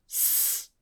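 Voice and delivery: a long, voiceless s hiss; a held s with no vowel. Shown as a demonstration. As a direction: keep the す hissing without a pause. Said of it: "というふうに発音し続けてください".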